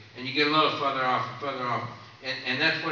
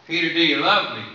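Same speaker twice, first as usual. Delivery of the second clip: louder and higher, pressing harder; pressing the question; 4 semitones higher, 8 dB louder than usual. Retold or imitated. Jesus pressing Peter a second time, louder and higher. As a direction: ask it again with real urgency, raised up.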